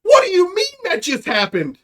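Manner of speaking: high, loud disbelief; hammed-up astonished reply; shocked outburst. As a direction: act out an incredulous official on the phone. UN official yelling into phone.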